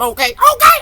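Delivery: squealed high and loud; "Okay" shrieked way up. Real, not acted.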